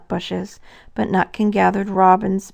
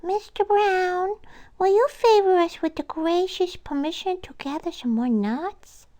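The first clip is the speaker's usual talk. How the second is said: high, courteous squirrel request; polite squirrel voice; 12 semitones higher than usual. Acted. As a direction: ask sweetly, like a small squirrel at a grand door. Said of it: "and said".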